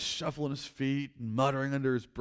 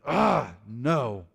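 a louder, mock-angry "Ah, no"; frustrated refusal; 2 semitones lower, 5 dB louder than usual. Pharaoh refusing God's command, mock-angry outburst. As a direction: refuse like a sulking tantrum. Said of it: "threw his deodorant in the air and said".

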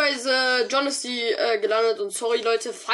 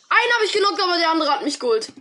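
agitated; 6 semitones higher, 4 dB louder, faster than usual. Real, not acted. Agitated after losing.

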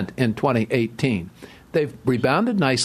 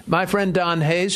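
usual speaking voice

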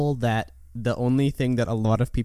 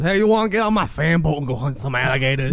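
put-on Cajun accent. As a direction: invite them out in a mock Cajun accent. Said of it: "I'm a hundred percent in on a Cajun accent".

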